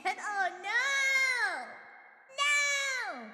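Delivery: high, drawn-out cry of comic dismay; rising squeal of refusal; dismayed wail. Real, not acted. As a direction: wail in protest, pitch climbing. Dismayed at song stuck in head.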